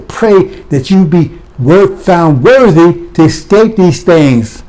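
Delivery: slow, deliberate quoting of Scripture; slow scripture reading. Shown as a demonstration. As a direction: read the verse slowly and solemnly. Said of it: "Ain't that what Scripture say?".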